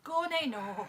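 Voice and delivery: mock Scottish accent; Scottish accent with a laugh. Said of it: "instead of saying why".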